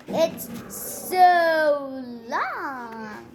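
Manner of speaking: a slow drawn-out mock from a child; lightly voiced teasing boy; mocking kid. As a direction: stretch it out to tease. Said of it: "said Joe".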